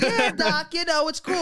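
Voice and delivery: high, breezy "Yeah, doc" playing it cool; slick cool reply. Telling the therapist it's cool, high and breezy. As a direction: act totally unbothered. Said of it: "I am so slick now".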